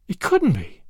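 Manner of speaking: a mild stunned gasp; gasped disbelief. A narrator's reading. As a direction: breathe it out in shock. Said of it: "she gasped at last".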